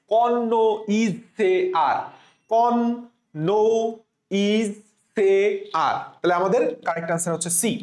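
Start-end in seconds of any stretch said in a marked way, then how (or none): 0.1-2.0 "কন, নো, ইজ, সে, আর" in separate beats; syllable by syllable. Shown as a demonstration. Teacher leads student reading along, syllable by syllable. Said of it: "তাহলে আমার সাথে পড়ো". 2.5-5.9 "কন, নো, ইজ, সে, আর" with a pause after each piece; syllable by syllable. Shown as a demonstration. Teacher has student read along, syllables spaced apart. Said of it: "তাহলে আমার সাথে পড়ো".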